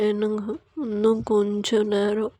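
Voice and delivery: soft, slow sleepy plea; sleepy wife. Acted. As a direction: murmur it softly, half asleep.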